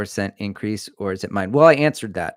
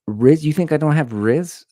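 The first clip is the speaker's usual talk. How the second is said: mock indignant; 7 semitones higher, 9 dB louder than usual. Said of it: "And I was like".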